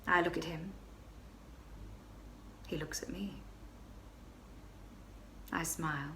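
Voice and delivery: breathy hushed storytelling; whispered confession. Acted. Baroness whispering the flirtation to her friend. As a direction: whisper it as if someone might overhear.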